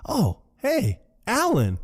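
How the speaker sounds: high-pitched excited greeting; super excited. Retold or imitated. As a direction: greet him in a high, mock-delighted voice. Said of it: "He's super excited about this".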